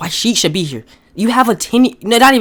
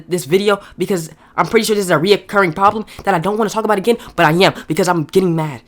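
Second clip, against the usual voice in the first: normal talking voice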